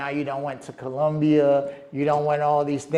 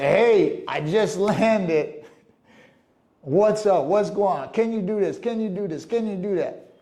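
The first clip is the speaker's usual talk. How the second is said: higher, eager mock caller rattling off requests; pushy friend; 5 semitones higher than usual. Mocking a visitor who calls the host only after landing, in a pushy higher voice. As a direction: play the entitled visitor who just landed.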